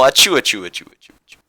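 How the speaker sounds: hammed-up fake sneezing; mock sneezes, loud then breathy. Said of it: "play us out, Emil".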